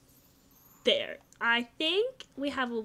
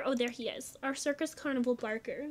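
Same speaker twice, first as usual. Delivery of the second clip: ordinary talk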